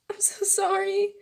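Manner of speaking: high, pleading apology; guilty apology. Acted. Girl feeling guilty after kissing boy, pleading.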